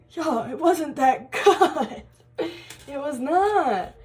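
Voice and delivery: slow, high, drawn-out denial with a laugh; laughing protest. Retold or imitated. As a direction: brush off the praise, amused and emphatic. Laughingly rejecting praise.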